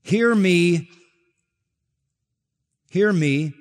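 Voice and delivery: "Hear me" set apart by long pauses; weighty "Hear me", repeated after a silence; emphatic. Retold or imitated. Preacher quoting the Lord's call to listen, "Hear me" emphatic. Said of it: "And it's emphatic".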